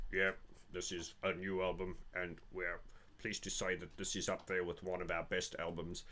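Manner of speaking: muttered band statement, mild imitation. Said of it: "He goes".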